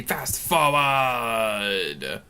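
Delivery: stretched out very slowly; drawn-out announcement. Shown as a demonstration. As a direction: drag each word out long. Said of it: "It's not gonna be fast forward".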